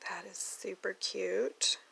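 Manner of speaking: soft slow whisper; slow hushed whisper. Real, not acted. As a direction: breathe it out in admiration. Whispered admiration.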